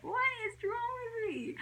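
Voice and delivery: extremely high, held squeal; retold laughing wail. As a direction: squeal it like you are helpless with embarrassment. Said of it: "I just laughed so hard".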